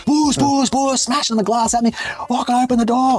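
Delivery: hammed-up furious yelling at the door; mock screaming. Woman smashing on the glass at the narrator, high mock scream. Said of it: "this bitch is going off".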